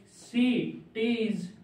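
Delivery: Cities drawn out slowly in two separated parts; cities broken in two for learners; syllable by syllable. Shown as a demonstration. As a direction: dictate cities very slowly, pausing before the last syllable. Breaks dictated word into syllables.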